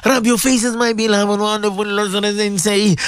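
sing-song supplication with long chanted ameens; swaying, chant-like congregational prayer; fervent prayer leading. Real, not acted. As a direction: lead listeners in heartfelt dua, stretching the holy words.

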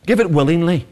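ordinary talk, nothing marked

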